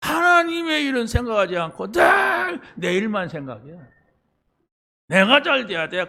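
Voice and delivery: high shouted reproach; preaching shout. Acted. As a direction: call it out to the congregation. Preacher scolding selfish believers.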